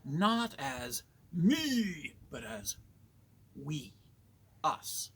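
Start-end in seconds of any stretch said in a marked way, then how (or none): 0.0-2.1 "me" drawn out long, high and loud; stress on ME. Real, not acted. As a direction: stretch and lift the word "me". Contrasts me with we.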